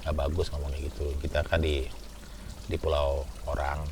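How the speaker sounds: a hushed, serious caution; pelan menasihati. Retold or imitated. Friend warns in quoted speech. (menasihati dengan suara rendah dan pelan)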